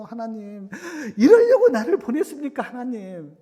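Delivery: loud, high wail of acted complaint; Moses' anguished reproach. Moses reproaching God who sent him, in anguish. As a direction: cry out in protest, falling on the last word. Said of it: "모세도 또 하나님께 원망하죠".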